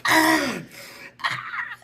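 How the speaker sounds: short high shrieks, the second breathy; high imitated screaming, rising to a near-silent squeal; mock screams. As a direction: shriek like a startled woman. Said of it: "they start screaming".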